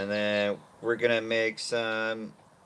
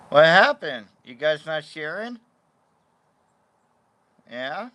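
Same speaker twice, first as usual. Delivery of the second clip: high, loud what happened; mock-surprised question to the dogs; surprised pet talk; 6 semitones higher, 9 dB louder than usual. Real, not acted.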